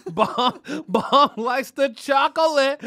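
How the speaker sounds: loud, high, drawn-out crowing; loud teasing. Real, not acted. Host ribs Bomb about his taste, loud teasing. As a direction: crow it slowly through a laugh. Teasing repeat, much louder.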